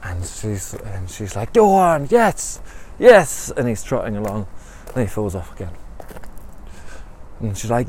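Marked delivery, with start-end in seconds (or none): 1.5-3.5 high, loud cheering; excited cheering. Acted. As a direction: cheer him on like a thrilled coach. Marianne cheers him trotting.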